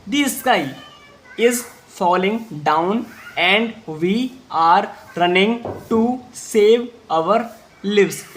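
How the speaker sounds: slow, word by word reading; slow read-aloud. Shown as a demonstration. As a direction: spell it out slowly for the class. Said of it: "एक बार फिर से बोलेंगे".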